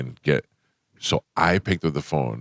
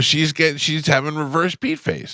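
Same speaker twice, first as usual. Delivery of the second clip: lightly raised teasing voice; a raised, playful jab; teasing quip; 9 semitones higher than usual. Retold or imitated. Teasing about Lisa's date photo, raised and playful. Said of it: "I go".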